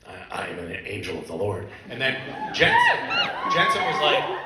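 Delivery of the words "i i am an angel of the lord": very low, softer gravelly angel; deep, gravelly voice. Retold or imitated. Actor demonstrating his first line on the show, deep gravelly angel. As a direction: drop low and solemn, gravel in it. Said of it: "gravelly voice. So I came on and I was like".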